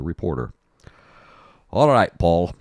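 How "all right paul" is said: playful put-on Scottish accent. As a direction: tease the weatherman in a Scottish brogue. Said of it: "that was a Scottish accent".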